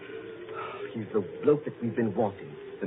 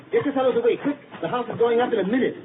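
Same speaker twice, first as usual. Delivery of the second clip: urgent warning call; 9 semitones higher, 4 dB louder, faster than usual. Acted. Warning police house will explode.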